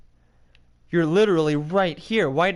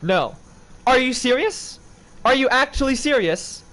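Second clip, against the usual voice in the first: high, loud what; incredulous outburst; outraged surprise; shocked disbelief; 9 semitones higher, 3 dB louder than usual. Real, not acted. Disbelief at puppet.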